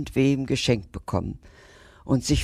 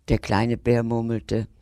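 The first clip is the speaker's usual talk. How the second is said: usual speaking voice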